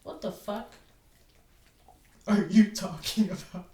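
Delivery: coworker's dumb question, answered with a loud baffled retort; retold incredulous retort. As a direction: fire it back at him, baffled. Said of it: "what I said was".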